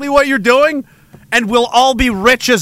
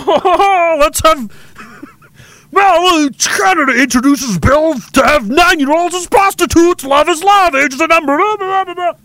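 high, hammed-up goofy voice; mock clowning Canadian; 7 semitones higher than usual. Canada clowning for warring countries, high mock-gleeful voice. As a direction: ham it up as the world's court jester.

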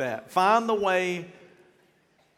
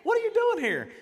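high-pitched, loud mock shout; startled daughter; 4 dB louder, slower than usual. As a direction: imitate a daughter caught off guard by Dad. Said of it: "this was her face like".

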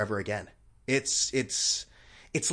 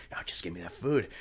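lower, softer greedy slob grunt; fat slob voice; 6 semitones lower, 6 dB quieter than usual. Retold or imitated. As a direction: play a lazy glutton wanting food. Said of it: "like a fat slob".

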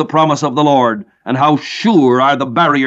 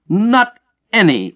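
"Not any" pitched higher and slow, each word weighted; emphatic NOT ANY; 6 semitones higher, slower than usual. Shown as a demonstration. Preacher translating the Greek for his listeners, high slow emphasis. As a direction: drive home "Not any" as an absolute. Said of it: "bring out the strength of the passage".

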